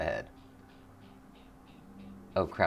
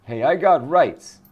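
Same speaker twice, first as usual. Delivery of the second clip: higher, louder, slow indignant yell; indignant shout; 8 semitones higher, 10 dB louder, slower than usual. Acted. Homeless man yells at the cop, indignant slow shout. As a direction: demand your rights.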